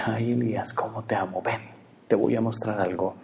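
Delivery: soft, half-whispered love; tender God. Retold or imitated. God holding Elijah, whispering his love softly. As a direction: hush it like an embrace. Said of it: "Y dice".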